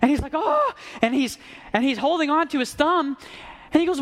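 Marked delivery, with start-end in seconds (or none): 0.3-0.7 pained cry. Retold or imitated. King's thumb blown off.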